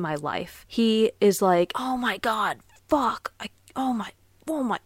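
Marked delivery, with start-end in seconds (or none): 1.8-4.8 mock a grown man throwing a fit over a cramp; temper tantrum. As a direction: imitate him, much higher, in a cursing fit. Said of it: "having an absolute temper tantrum".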